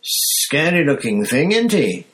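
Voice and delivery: a bus conductor savouring a grim story; Stan's voice. Acted. Stan voiced with relish.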